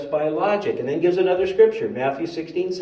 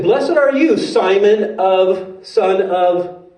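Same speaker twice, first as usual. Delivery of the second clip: high, loud, drawn-out blessing; ringing, raised-voice pronouncement; loud proclamation; 5 semitones higher, 6 dB louder, much slower than usual. Acted. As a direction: proclaim it slowly and grandly. Jesus proclaims a blessing, hunting name.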